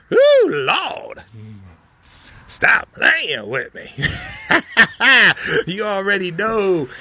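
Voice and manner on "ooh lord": high, louder ooh lord; excited ooh. Real, not acted. Host overjoyed at the cabins, high loud ooh lord. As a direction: gasp it out with glee.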